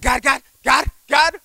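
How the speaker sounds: high, frantic repeated cries; panicked calling on God. Retold or imitated. Trucker crying out as his rig veers off road. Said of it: "I was like".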